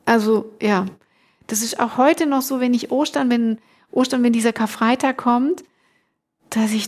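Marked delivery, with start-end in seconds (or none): none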